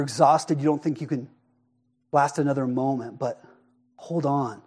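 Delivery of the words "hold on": hushed, soft reassurance; softly reassuring the drowning disciples; tender comfort. Acted. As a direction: speak it gently to the exhausted. Pastor comforting weary listeners.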